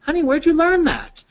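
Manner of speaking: high-pitched, questioning receptionist; lightly acted, puzzled adult talking to a child. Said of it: "the receptionist says".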